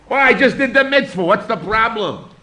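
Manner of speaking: loud, high mock protest; a hammed-up indignant excuse; dismissive objector. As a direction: play the shrugging skeptic who sees no problem. Rabbi voices a dismissive objector.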